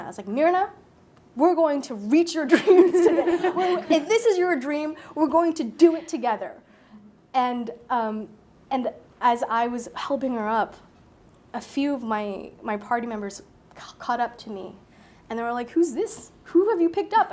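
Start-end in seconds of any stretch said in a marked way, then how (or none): 0.3-6.5 high, loud, laughing pep talk; rally her with a laughing, beaming cheer; excited encouragement. Retold or imitated. To Mirna on the steep climb, a loud laughing cheer. Cheering on struggling older woman. 15.7-17.3 surprised question. Retold or imitated. Friends catch up, surprised.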